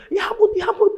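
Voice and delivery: high-pitched mock fluster; acted panic. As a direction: squeal helplessly as if panicking. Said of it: "Nah, itu panik". (panik dibuat-buat, suara melengking)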